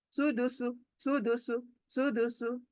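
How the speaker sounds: सुदुसु in one quick run, softly; quick short vowels. Shown as a demonstration. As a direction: keep सुदुसु short, no stretching. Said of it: "practice ही करेंगे जल्दी से". (जल्दी से सुदुसु, बिना खींचे)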